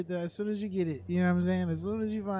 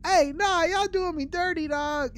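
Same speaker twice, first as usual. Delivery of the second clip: loud frustrated yelling at the game; 6 semitones higher, 6 dB louder than usual. Real, not acted. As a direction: yell it out, fed up. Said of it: "Sorry for yelling so loud".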